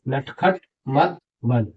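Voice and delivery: नटखट मत बन read out slowly word by word; slow reading demo. Shown as a demonstration. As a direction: spell it out slowly for the class. (धीरे-धीरे पढ़कर दिखाना)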